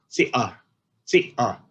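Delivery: "si-ah" said slowly, syllable by syllable; second syllable demo. Shown as a demonstration. Lecturer breaking the name into syllables, slowly sounded out. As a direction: spell out "si-ah" by sound. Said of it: "then comes the second syllable".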